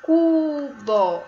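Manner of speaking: cubo as a high, loud CUU-BÓ; teacher sounding out cubo clearly; syllable by syllable. Shown as a demonstration. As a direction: draw out cu, then bó. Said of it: "A primeira sílaba é cu".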